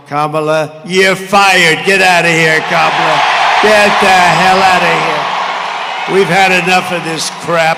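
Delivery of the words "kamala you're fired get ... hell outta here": loud rally shout, dragged out at the end. Acted. Telling Kamala off to the cheering crowd, loud rally shout. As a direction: bellow it to the crowd like a dismissal.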